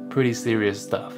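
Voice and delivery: ordinary delivery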